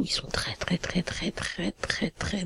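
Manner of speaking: hushed whispered confiding. Real, not acted. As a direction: whisper it like a secret about money. Parle tout bas tout du long. (chuchoté, en confidence)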